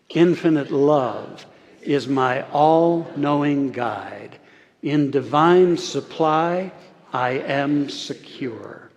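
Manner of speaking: slow, measured, each word drawn out; slow group recitation. Shown as a demonstration. Minister leading the congregation in slow unison recital. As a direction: lead the congregation through it slowly. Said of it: "Say this with me".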